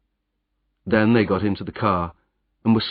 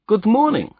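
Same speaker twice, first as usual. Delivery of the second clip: a much higher, louder suspicious stranger; mock-friendly greeting; 13 semitones higher, 5 dB louder than usual. German agent greets the roadman with false cheer.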